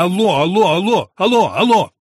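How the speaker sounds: repeated loud, high yells into the phone; frantic volley of telephone shouts; shouting. A narrator's reading. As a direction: keep yelling hello, rising in pitch. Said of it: "закричал я".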